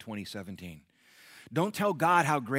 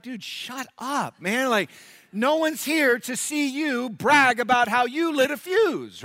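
much higher, slow exasperated put-down; fed-up bystander; 13 semitones higher, 9 dB louder, slower than usual. Retold or imitated. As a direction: tell the show-off to shut up, slowly. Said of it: "you'd be like".

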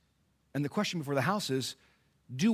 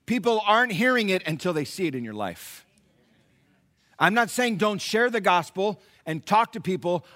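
ordinary delivery

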